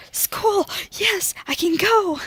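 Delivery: high, loud burst of excitement about school; eager cheer. Retold or imitated. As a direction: leap at the chance, nearly shouting. Mock eager reply to school.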